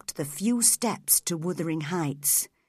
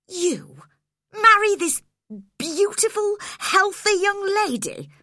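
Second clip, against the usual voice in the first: much higher, louder outraged cry; indignant cry; 12 semitones higher, 5 dB louder than usual. Acted. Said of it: "I cried".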